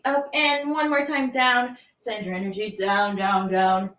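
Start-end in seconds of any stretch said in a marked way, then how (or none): none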